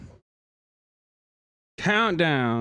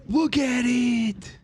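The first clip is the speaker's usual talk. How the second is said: slow, high, shouted in delight; excited shout; 10 semitones higher, much slower than usual. Real, not acted. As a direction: shout it out, thrilled. Showing off the new setup.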